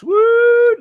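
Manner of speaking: high, loud "woo"; drawn-out high-pitched cheer; excited whoop. Real, not acted. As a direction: let out a big high whoop. Whoops during the show's opening.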